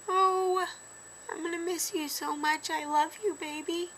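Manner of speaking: high-pitched, choked-up farewell; tearful. Real, not acted. Telling the baby she'll be missed during last makeup, tearful. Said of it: "I know I'm gonna cry".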